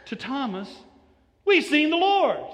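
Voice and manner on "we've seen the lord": very high, loud We've; excited insistence. Acted. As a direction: cry it out, desperate to convince. Disciples insisting to Thomas.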